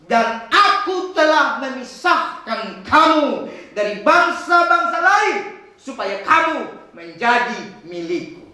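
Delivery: very slow, measured scripture reading; slow solemn reading. Acted. Preacher closing God's words to the congregation, slow and solemn. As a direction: let every word of the verse land slowly.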